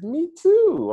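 a chirpy, overeager agreement; eager squeaky me too. Retold or imitated. Excited stranger matching answers.

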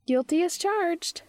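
high, slow, playful confession; Charlie. A narrator's reading. Charlie owns up to being a nerd, high slow voice. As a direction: draw it out in a high voice.

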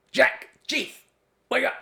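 a friend yelling down the phone at 3am; excited drunk friend. Retold or imitated. Drunk friend calling from Peru.

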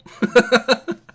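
big loud squealing laugh; cracking up at the shirt gag; loud high laugh. Real, not acted. Laughs at Daredevil shirt joke.

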